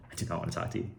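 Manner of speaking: hushed mocking echo; quiet mock repeat. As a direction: mutter it back with disbelief. Repeats buyer's line mockingly.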